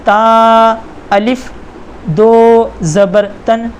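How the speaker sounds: drawn-out teacher spelling of दो ज़बर तन; slow spelling drill. Shown as a demonstration. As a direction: spell दो ज़बर तन slowly, syllable by syllable. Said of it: "यहाँ पर लिखा है ता अलिफ". (धीमी हिज्जे की आवाज़)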